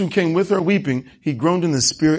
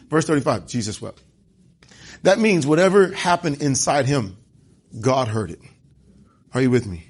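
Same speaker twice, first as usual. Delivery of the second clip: ordinary delivery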